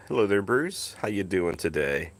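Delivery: usual speaking voice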